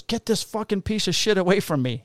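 high-pitched imitation of a Greek accent; mock an old man's Greek accent, pitched high; thick Greek accent. Old Greek father rejecting his son, in an imitated Greek accent. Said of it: "in his thick Greek accent".

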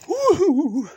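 high, loud "woo-hoo"; loud whoop. Real, not acted. Host whooping as he signs off, loud whoop. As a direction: let out a big high-pitched whoop.

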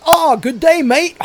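high, loud hammed-up Aussie accent; cheery over-the-top Aussie g'day; mock Australian greeting. Driver greets the passenger, loud mock Australian. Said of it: "And he goes".

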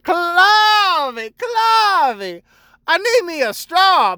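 demanding customer. Retold or imitated. Customer calling the barista by name, high whiny demand. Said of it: "be like".

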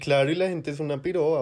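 ordinary talk

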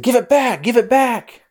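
put-on high, loud kid yelling to get it back; girl yelling at the bully to give it back; shouted demand. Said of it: "She said".

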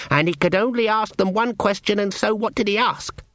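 a keen student laying out a logic puzzle; eager young wizard. A narrator's reading. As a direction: Ponder eagerly telling the logic puzzle to the coach. Ponder explains the puzzle.

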